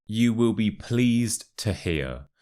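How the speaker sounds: slow, careful teaching pronunciation; slow model phrase. Shown as a demonstration. Teacher models the first expression for learners, slow and clear. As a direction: say it slowly, word by word, for learners. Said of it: "That's the first expression".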